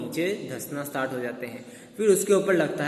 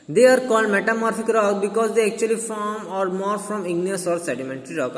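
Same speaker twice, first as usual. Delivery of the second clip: slow, deliberate dictation read, a bit louder; slow textbook reading; 5 semitones higher, 5 dB louder, much slower than usual. Acted. Teacher reading the definition to students, slow and deliberate. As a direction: read each English word out slowly for the class.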